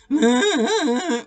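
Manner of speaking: a loud, high cow bellow; bellowing moo. A narrator's reading. Belinda snatching the carrot from Tom, a loud bellowing moo. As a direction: bellow it like a cow grabbing food. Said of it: "bellowed Belinda".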